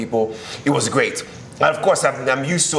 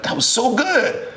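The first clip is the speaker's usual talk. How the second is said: higher and slow, admiring; impressed praise; 12 semitones higher, slower than usual. Retold or imitated. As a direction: savour the praise, drawn out. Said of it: "And like".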